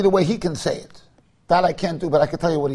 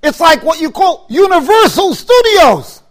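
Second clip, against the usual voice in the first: loud, high, slow declaring; rabbi's proclamation; 6 semitones higher, 6 dB louder, slower than usual. Retold or imitated. Quoting Rav Gifter to the listeners, a loud slow proclamation. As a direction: proclaim it like a rabbi thundering from the pulpit. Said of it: "He said".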